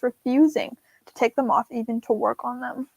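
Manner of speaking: ordinary delivery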